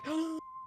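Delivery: sharp surprised intake of breath; mock astonished gasp; imitated gasp. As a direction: gasp as if just let in on a secret. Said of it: "she's like".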